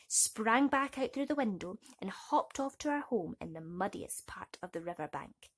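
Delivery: plain reading voice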